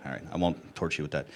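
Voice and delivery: normal talking voice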